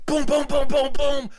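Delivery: high, loud rapid-fire booms; frantic knocking imitation. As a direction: pound the door with your voice. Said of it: "Knock more".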